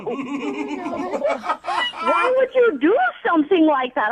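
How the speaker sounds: indignant retort. Real, not acted. Caller angrily turns it back.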